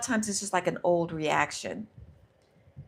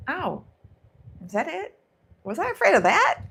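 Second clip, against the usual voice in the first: higher, incredulous questioning, louder at the end; acted surprised realization; 10 semitones higher, 6 dB louder than usual. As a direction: play pleasantly baffled relief, pitch jumping up. Acted surprise after facing fear.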